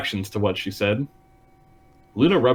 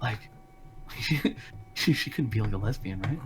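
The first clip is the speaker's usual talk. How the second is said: much softer, secretive gossiping murmur; mock hushed whisper; 2 semitones lower, 14 dB quieter than usual. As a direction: lean in and hush it like a scandal. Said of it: "hushed whispering about it".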